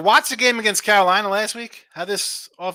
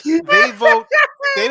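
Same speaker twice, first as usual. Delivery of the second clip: emphatic burst; 8 dB louder, much slower than usual. Real, not acted. Heated point about rankings.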